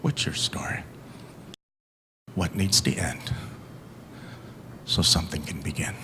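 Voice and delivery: hushed, unvoiced closing question; whisper. Asking the audience a last question in a whisper. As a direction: whisper it close to the listener.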